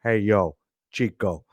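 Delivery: toy wrestler voice. Pretend. Host voicing his Razor Ramon toy, lightly voiced. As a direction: drop low for a toy wrestler's catchphrase. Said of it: "I can do voices for show, for toys".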